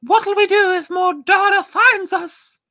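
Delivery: very high, loud squeal; squeaky put-on panic. Player asks Zarkon for help, high loud squeaky voice. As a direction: squeal it shrilly, far above normal voice. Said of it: "You whisper to Zarkon".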